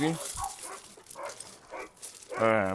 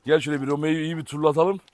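ordinary talk

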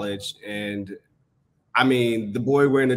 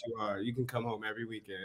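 soft fatherly voice; 14 dB quieter than usual. Retold or imitated. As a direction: humor the kid quietly. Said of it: "He was like".

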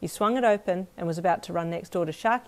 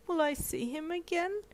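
put-on small child; 12 semitones higher, 4 dB quieter than usual. Child voice in sad scene.

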